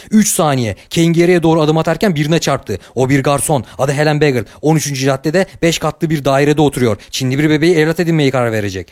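usual speaking voice

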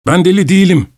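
high, quick blurted protest; blurted denial. Acted. Madman blurts to the traveller that he's not mad, high-pitched. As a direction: jump in to deny being mad. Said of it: "diye atıldı".

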